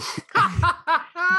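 high gleeful cackle; gleeful laugh. Real, not acted. Giving away roommate's clothes at work, gleeful high laugh. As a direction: laugh with mischievous delight.

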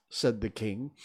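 ordinary delivery

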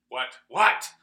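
sudden high, loud shout; imitated scream. Retelling mom's scream from the bedroom, loud imitated scream. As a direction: cry out loudly, as the mother screamed. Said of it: "I hear my mom scream".

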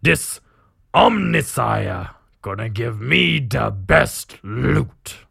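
slow, drawn-out orc drawl. Acted. Orc captain planning his next raid, slow orc voice. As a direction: relish it slowly as an orc boss. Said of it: "you read an orc talking".

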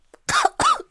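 sharp real coughing fit; sudden choking cough. Real, not acted. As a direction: choke mid-sentence. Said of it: "Not me choking".

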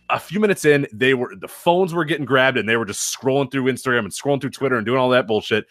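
ordinary talk, nothing marked

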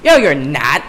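lightly voiced sassy villainess; botched New York accent; brash put-on comeback; Harley Quinn. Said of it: "That was a shitty Harley Quinn impression".

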